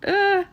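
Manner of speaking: nervous groan. Real, not acted. Nervous cutting banners.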